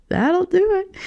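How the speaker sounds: high, slow, laughing; high laughing repeat. Shown as a demonstration.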